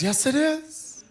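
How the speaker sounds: high, slow, wounded reply; a hurt woman holding back tears; mock tearful answer.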